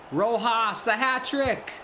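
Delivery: thrilled call of a hat trick chance; excited. Real, not acted. Gamer calling a near hat trick, high and excited. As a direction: pitch leaps up on the name.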